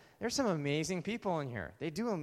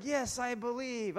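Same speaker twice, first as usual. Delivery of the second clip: high, drawn-out affirmation; slow, pitched-up enthusiastic yes; eager believer; 10 semitones higher, slower than usual. Acted. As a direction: declare it with mock-eager zeal. Imitates eager congregation agreeing.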